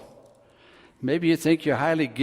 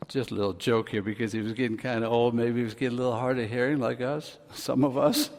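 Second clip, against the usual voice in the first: ordinary talk, nothing marked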